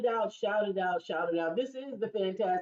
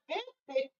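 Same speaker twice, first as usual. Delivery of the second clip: surprised disbelief; 10 semitones higher than usual. Retold or imitated. Mock disbelief at daughter's claim.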